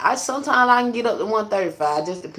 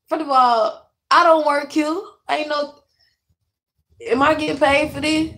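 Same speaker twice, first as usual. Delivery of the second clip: indignant; 7 semitones higher, 3 dB louder than usual. Retold or imitated. Indignant retort to mom's demand.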